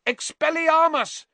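high, loud shouted incantation; full-voiced high battle cry; yelled spell. A narrator's reading. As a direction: yell it out like casting a spell. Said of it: "he yelled".

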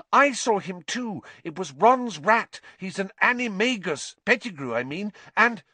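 high, eager explaining; urgent girl. A narrator's reading. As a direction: rush to convince.

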